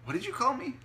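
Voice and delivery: high, loud indignant snap; snapped. A narrator's reading. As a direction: snap back, offended. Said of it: "snapped Sushi".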